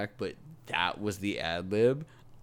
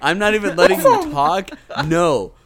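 much higher and louder, flat-out refusing; laughing refusal shout; 9 semitones higher, 14 dB louder than usual. Real, not acted. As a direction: shout no over the others, half laughing. Refusing to let Navi be picked.

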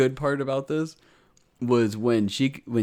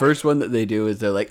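usual speaking voice

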